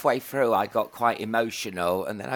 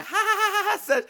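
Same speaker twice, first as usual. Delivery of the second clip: mock laughing. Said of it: "And she's like".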